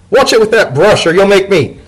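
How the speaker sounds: high, loud alarmed yelp of a voice; high yell from chimney. Acted. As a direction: shout up from inside the chimney in a high pitch. Dodded stuck in chimney, yelling.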